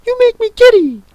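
shrill, loud complaint; piercing royal tantrum; screaming Queen. A narrator's reading. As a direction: shriek it very high, exasperated. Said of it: "screamed the Queen".

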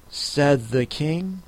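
ordinary delivery, nothing marked

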